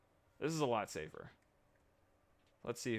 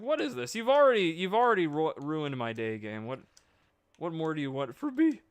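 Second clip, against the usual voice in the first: mock despair; 8 semitones higher, 6 dB louder than usual. Real, not acted. Addresses game, dramatic.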